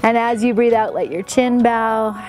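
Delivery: guided meditation. Acted. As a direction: talk the class gently into a calm breath. Opens class with breathing meditation.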